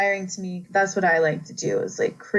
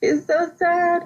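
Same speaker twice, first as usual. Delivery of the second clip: rising, slow, higher whimper of pity; mock heartbroken; 5 semitones higher, slower than usual. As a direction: coo over the poor baby, heartbroken. Her own reaction quoted, distressed.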